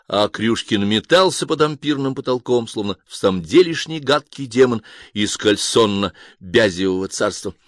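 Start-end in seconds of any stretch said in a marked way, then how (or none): none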